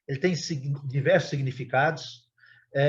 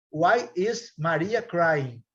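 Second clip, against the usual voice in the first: slow model question; 2 semitones higher, much slower than usual. Shown as a demonstration. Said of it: "teacher models another example".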